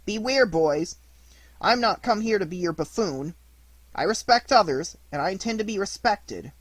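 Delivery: loud, stern warning to bullies; angry warning. Acted. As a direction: stand up to them, firm and loud. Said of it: "looking very angry".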